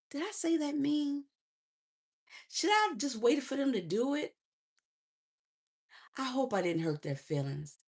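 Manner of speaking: mock anxious self-questioning; acted second-guessing. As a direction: replay your doubts quietly to yourself. Voicing her overthinking afterwards.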